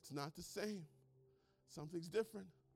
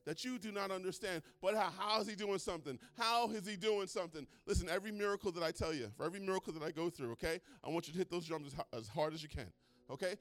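usual speaking voice

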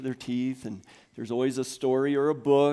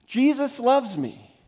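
higher, louder chant; 11 semitones higher, 8 dB louder, slower than usual. Shown as a demonstration. Pastor leading congregation call-and-response, loud. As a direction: lead the room in saying it. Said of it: "say it out loud with me".

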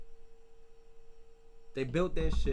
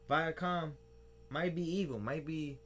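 ordinary delivery

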